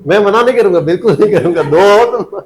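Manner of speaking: jokingly insist, laughing at the end. Real, not acted. Teacher jokes about accepting gifts. (मज़ाक में, हँसते हुए)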